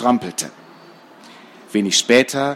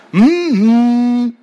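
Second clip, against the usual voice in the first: loud, high muffled "mmm-hmm"; taped-mouth hum; 11 semitones higher, 7 dB louder than usual. A narrator's reading. Gagged boy signals his sister, muffled humming. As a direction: hum urgently through taped lips. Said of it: "kaum zu hören war, weil die Wächter ihnen widerlich klebriges Paketklebeband".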